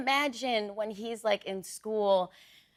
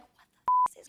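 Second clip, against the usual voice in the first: squeaky, very high astonished cut-off; mock shocked gasp; slower than usual. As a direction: pretend to be floored. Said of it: "And you're like".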